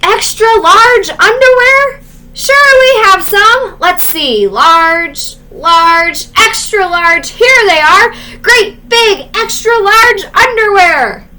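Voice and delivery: booming shop clerk shouting; shouting shopkeeper. Acted. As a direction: yell it across the shop like a bullhorn.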